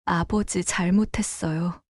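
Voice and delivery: soft, subdued admission; lightly acted tearful apology; tearful daughter. Daughter admits fault to her father, quietly tearful. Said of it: "제대로 울기 시작했습니다".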